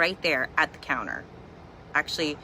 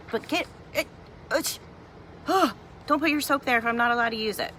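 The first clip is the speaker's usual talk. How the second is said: indignant rant; 8 semitones higher, faster than usual. Retold or imitated. Rant mocking the clerk.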